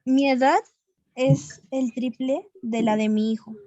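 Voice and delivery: ordinary delivery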